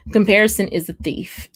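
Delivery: ordinary delivery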